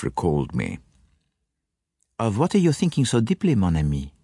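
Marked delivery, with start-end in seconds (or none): 2.2-4.1 higher, quicker Belgian detective voice; fussy little Belgian asking his friend; French-accented Poirot. A narrator's reading. Poirot speaks to Hastings.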